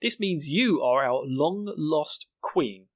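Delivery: slow, solemn mock proclamation; envoy lady impression. Lady from another nation hails Mikasa, slow proclamation. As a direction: announce it grandly, word by word. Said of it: "the lady who's from this other nation".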